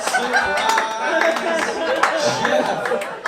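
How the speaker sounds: spring it on him loudly like a party host; loud mock surprise. Swollen rider greeting shocked Uber driver, loud mock surprise.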